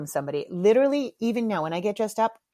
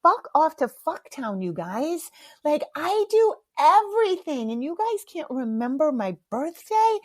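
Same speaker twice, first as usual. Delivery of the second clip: retold hurt outburst, pitch climbing high; tearful outrage; 7 semitones higher than usual. Mom to family who forgot her birthday, hurt outrage. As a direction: re-enact an indignant, high-pitched protest. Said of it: "I started to cry. I was like".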